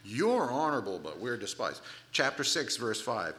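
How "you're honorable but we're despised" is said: slow, drawn-out mock humility and praise; swooping, stretched-out irony; sarcastic. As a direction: exaggerate each contrast with heavy sarcasm. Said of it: "he starts getting sarcastic".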